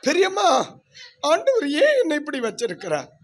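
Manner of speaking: loud, high-pitched hammed-up lament; mock wailing. Preacher mimics someone crying to an aunt about God, mock wail. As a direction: cry out in exaggerated complaint.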